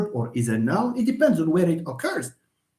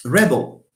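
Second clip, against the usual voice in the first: "Rebel" as REB-ul, louder up front; stress on first syllable; 2 semitones lower, 5 dB louder than usual. Shown as a demonstration. Teacher models the noun, "Rebel" stressed on the first syllable. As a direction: hit "Reb" and let the end drop. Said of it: "stressful here".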